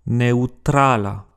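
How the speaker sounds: "neutrala" slowly as neu-tra-la; "neutrala" drawn out, eu as one diphthong; three syllables. Shown as a demonstration. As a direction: glide e into u in "neutrala". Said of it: "trois syllabes".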